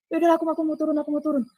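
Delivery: high-pitched wish to leave; retold insistent plea. Woman tells the man she wants to go down, insistent. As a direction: re-enact pressing to be let go, pitched high.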